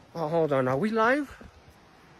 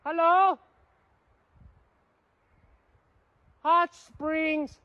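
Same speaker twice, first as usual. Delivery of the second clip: high, drawn-out call into the woods; 11 semitones higher, 4 dB louder, much slower than usual. Real, not acted. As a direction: call out loud for the hot springs. Calling out across the forest.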